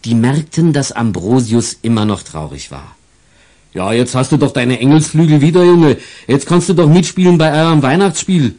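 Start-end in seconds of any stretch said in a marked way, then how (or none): 3.7-8.6 louder, coaxing officer voice; cheerful policeman. Acted. The policeman tries to cheer up the sad angel boy, coaxing.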